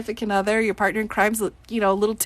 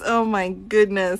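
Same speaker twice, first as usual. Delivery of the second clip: ordinary talk, nothing marked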